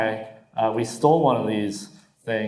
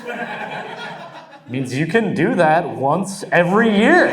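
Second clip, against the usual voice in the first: punchline breaks into a high laughing squeak; 6 semitones higher, 3 dB louder, slower than usual. Real, not acted. Comic telling the crowd, laughing.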